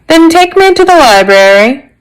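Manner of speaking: high-pitched official order; commanding inspector. Acted.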